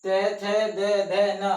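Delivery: ते, थे, दे, धे, न called out loudly, each letter separate; loud classroom recitation of the letters; loud consonant drill. Shown as a demonstration. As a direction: hammer out the dental row loudly. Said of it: "इसको उच्चारण कीजिए।".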